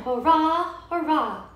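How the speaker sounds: ordinary delivery, nothing marked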